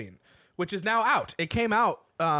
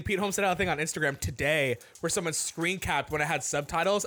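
normal talking voice

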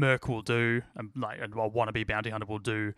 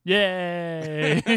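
sarcastic cheer; 7 semitones higher, 6 dB louder than usual. Acted. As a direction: fake happy yay, high and bright. Sarcastic cheer at plans failing.

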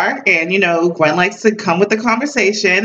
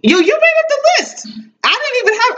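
high protest. Real, not acted. Protesting the list was his.

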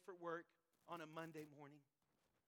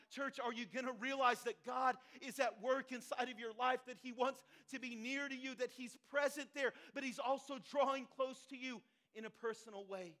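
louder, urgent appeal to a congregation; impassioned preaching; 4 semitones higher, 8 dB louder than usual. Acted. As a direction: press the question on the church with force. Preaches at full force.

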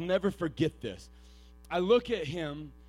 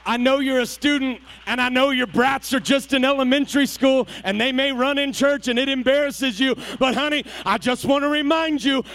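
louder and higher, pleading with the congregation; preaching at full force; 9 semitones higher, 15 dB louder than usual. Acted. Preacher reassuring students and young parents, full force. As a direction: pour it out over the pews.

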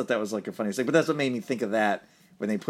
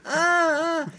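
much higher, louder cry of pain; 4 dB louder than usual. Retold or imitated. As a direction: yelp like someone who just broke his legs. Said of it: "And he's like".

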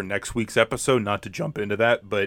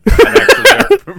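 excited; 11 dB louder than usual. Real, not acted. Proud of the show, gushing.

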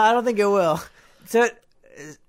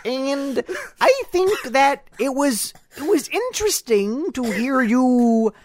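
Kermit the Frog; 3 semitones higher, slower than usual. Acted. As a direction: drawl it out like a Muppet frog. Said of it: "it's from my friend Kermit the Frog".

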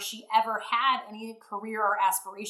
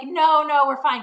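louder, higher quick no; shutting him down, loud and bright; firm refusal; 6 semitones higher, 10 dB louder than usual. Retold or imitated. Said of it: "And I was like".

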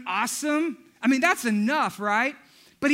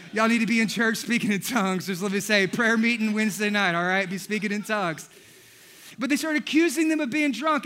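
normal talking voice

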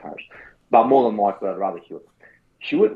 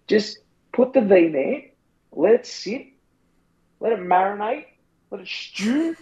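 sing-song advice; 8 semitones higher than usual. Pretend. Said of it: "coaching advice".

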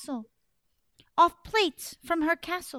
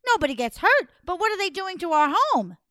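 alarmed disbelief; 5 semitones higher, 6 dB louder, faster than usual. Acted. Frightened daughter questions father.

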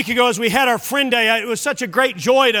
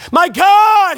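high, loud anguished cry from the cross; preacher's full-voiced quoted cry; 6 semitones higher, 7 dB louder than usual. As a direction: cry it out, drawing out GOD. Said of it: "he cried out with a loud voice".

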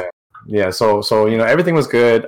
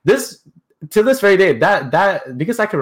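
emphatic; 9 semitones higher, 4 dB louder than usual. Real, not acted. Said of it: "this is crazy".

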